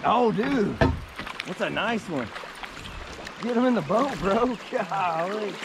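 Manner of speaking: high, loud thrill; hyped up, pitched way high; real excitement. Real, not acted. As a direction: whoop it up over a big catch. Reacts to a big catch.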